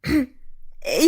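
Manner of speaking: small awkward ahem; throat clearing. A narrator's reading. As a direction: clear your throat before speaking. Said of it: "وتين بحمحمة". (حمحمة)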